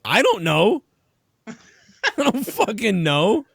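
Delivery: high-pitched, exasperated cluelessness; imitated bafflement. Teller replays his answer to the bartender, high and baffled. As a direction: protest that you have no idea.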